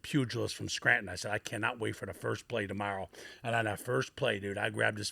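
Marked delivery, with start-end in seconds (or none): 0.0-3.1 low, soft inner resolve; talking to himself. Retold or imitated. Rookie alone upstairs steeling himself, quiet grim vow. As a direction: mutter a grim vow to yourself. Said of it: "coming to Jesus meeting with myself".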